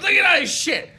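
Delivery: high, loud, laughing mock outrage; laughing drunk mom. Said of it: "she goes".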